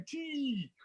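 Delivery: high, soft mimicked squeal; mock catchphrase. Presenter asks why he'd say it, mocking high squeal. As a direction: parody the catchphrase, high and trailing. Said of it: "why would I go".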